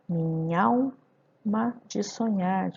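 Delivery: "minha alma" merged as mi-nhal-ma; slow, "nha al" as one syllable; poetic scansion. Shown as a demonstration. Teacher scanning the verse, slowly eliding "minha alma". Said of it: "quando eu fizer a escansão".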